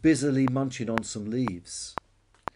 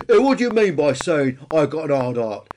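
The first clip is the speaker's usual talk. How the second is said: loud, raised, offended dinosaur voice; indignant bonehead Peter; 4 semitones higher, 11 dB louder than usual. A narrator's reading. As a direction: challenge the brontosaurus loudly. Peter challenges Billy.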